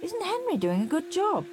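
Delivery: proud mother, gently impressed; Mum's voice. A narrator's reading. Mum praising her son to dad, pleased mum voice. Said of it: "said Mum".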